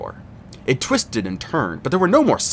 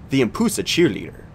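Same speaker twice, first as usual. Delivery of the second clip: ordinary delivery, nothing marked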